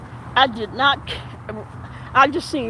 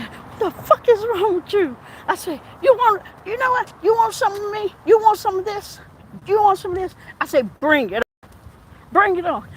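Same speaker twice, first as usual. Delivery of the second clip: street confrontation; 8 semitones higher than usual. Retold or imitated. Officer losing temper, taunting the patient with high street taunts. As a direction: square up and dare her to fight. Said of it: "like she was off the street".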